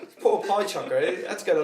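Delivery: high laugh, then speaking through it; real laughter spilling into words. Real, not acted. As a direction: laugh while pitying him. Laughing at the story.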